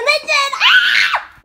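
startled scream. Real, not acted. Spotting surviving ants under the rag, real startled scream. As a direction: shriek as the ants scatter.

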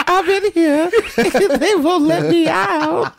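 put-on very high, slow, laughing old-lady voice, words breaking up with laughter; a feeble trapped old lady calling through a locked door, giggling; high feeble Ebony voice. Friend mocks Ebony trapped behind a locked door, high feeble voice. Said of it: "Ebony would be talking through the door".

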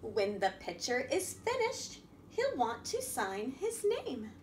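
lively picture-book reading voice; reading to children. A narrator's reading. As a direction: read it out to a room of little kids.

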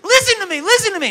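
put-on high, whiny little-kid plea; pleading child. Child begging her mom in prayer meeting to listen, high and whiny. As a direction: beg like an impatient kid.

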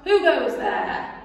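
loud, slow villain challenge; rat crying out. Acted. Rat blocking the rabbit's road, loud and slow. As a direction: boom it out to stop her. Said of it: "who cried out".

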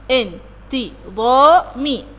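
slow, even beats: ان تي ظا مي; steady syllable count ان تي ظا مي; syllables again in a row. Shown as a demonstration. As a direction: say ان تي ظا مي one syllable per beat. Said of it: "يبقى أنا عندي".

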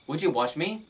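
ordinary talk, nothing marked

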